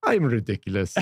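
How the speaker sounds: put-on rock-star voice, tinged with laughter; imitated self-mocking declaration. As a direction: confess it in a mock guitar-hero voice. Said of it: "And he goes".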